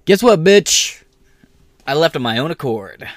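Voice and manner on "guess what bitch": higher, louder taunt, spitting it out; gloating shout. Real, not acted. Mocking the HR head who threatened his job, a gloating shout. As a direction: crow it like you won.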